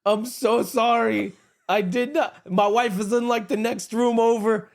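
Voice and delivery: high, slow mortified apology; flustered apology. Retold or imitated. Husband apologizing to a stranger's family, flustered high apology. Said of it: "I'm like".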